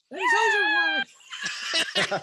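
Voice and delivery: high, loud and slow, stretched for suspense; dramatic build-up. Acted. Reading the comic page to friends, hammed-up suspense. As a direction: draw it out like a cliffhanger.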